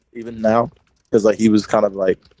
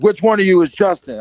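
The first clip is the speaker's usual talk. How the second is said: higher-pitched imitation of a stern teacher; firm retold demand, not yelling; 6 semitones higher than usual. Teacher questioning kids at recess, imitated as stern. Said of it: "saying it really stern".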